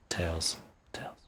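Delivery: breathy whisper of the name. Shown as a demonstration. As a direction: hush it to a whisper.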